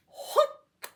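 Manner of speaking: usual speaking voice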